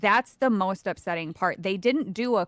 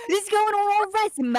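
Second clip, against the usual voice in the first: higher, mock-proud British accent; 9 semitones higher, 3 dB louder, faster than usual. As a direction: boast as the hired actors in a British accent. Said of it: "choosing a British accent for them".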